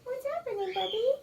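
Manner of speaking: high sweet voice for a shy cat; soft pet talk. Shown as a demonstration. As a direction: coo gently at the kitty. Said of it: "You just wanna say".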